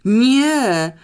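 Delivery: high, loud, drawn-out cry of disbelief; shocked shout. A narrator's reading. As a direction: shout it out, badly shaken. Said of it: "krzyknął Rafał okropnie poruszony". (wstrząśnięty, głośny krzyk)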